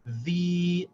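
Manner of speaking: THE said as a long-held "dhee"; long vowel. Shown as a demonstration. Teacher reading dal with a long vowel, sustained. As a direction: stretch THE into a long ee. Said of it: "read the dal with a long vowel".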